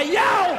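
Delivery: high hammed-up yowl of pain; mock pain yelp. Dancing on a broken ankle, mock high yowl.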